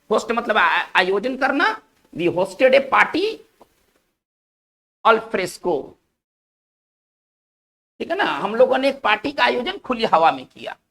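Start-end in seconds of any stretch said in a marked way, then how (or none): 2.1-5.8 dictation pace. Shown as a demonstration. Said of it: "dictating an example sentence".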